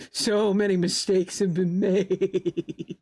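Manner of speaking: slow, drawn-out words dissolving into giggles; laughing ruefully. Real, not acted. Owning up to too much shopping, laughing ruefully. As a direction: drag it out, shaking with laughter.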